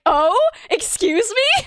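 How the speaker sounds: high, slow imitated questioning; surprised mom. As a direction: draw out a mother's astonished question. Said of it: "my mom was like".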